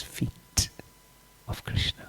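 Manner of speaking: a slow hushed whisper at the sacred moment; reverent whisper. A narrator's reading. Teacher tells Shiva touching Krishna's feet, slow reverent whisper. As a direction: whisper it slowly, full of awe. Tender climax of the story.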